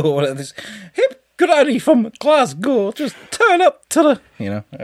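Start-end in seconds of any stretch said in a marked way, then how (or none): 0.9-4.2 very high, drawn-out granny voice; hip Glasgow granny. Acted. Host imagines a random granny guest, high-pitched granny impression. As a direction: put on a squeaky Scottish granny. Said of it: "a hip granny from Edinburgh or Glasgow".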